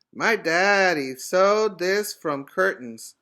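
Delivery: put-on boy proud of his dad's sewing; proudly. A narrator's reading. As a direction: show off the cape with pride. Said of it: "he said proudly".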